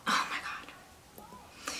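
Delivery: breathy, barely-there whisper; whispered awe. Real, not acted. Vlogger raving to viewers about her favorite gloss, awed whisper. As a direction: whisper it slowly, as if overwhelmed.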